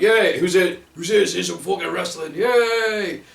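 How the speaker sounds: high, loud imitation of an excited crowd; hammed-up rowdy yay; mock crowd cheer. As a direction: cheer along without knowing who it is.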